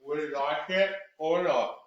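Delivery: slow, each syllable drawn out around the fingers; with fingers in mouth, very slow. Shown as a demonstration. Cork work tongue twister with fingers in the mouth. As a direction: articulate every syllable slowly. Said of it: "One more time".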